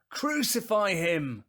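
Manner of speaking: very loud, high, drawn-out yell; full-force, high crowd shout. Acted. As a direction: roar it slowly as an insistent mob. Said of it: "they shouted all the louder".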